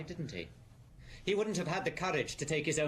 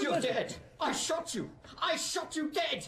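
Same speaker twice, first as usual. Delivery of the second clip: much higher, shrill insistence he shot him; panicked disbelief; 12 semitones higher, slower than usual. Acted. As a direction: voice climbing in hysteria. Killer sees victim alive.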